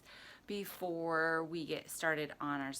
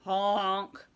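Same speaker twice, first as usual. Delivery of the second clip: horn honk, mildly raised voice; mild yelled honk; 5 dB louder than usual. A narrator's reading. Dump truck yelling at the animals, mild honk. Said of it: "yelled a dump truck".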